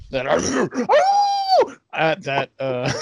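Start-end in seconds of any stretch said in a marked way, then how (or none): 0.4-1.7 high, sustained howl; werewolf howl. Acted. Improv player turning into a werewolf, high howl. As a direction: break into a wolf howl. Werewolf howls mid-sentence.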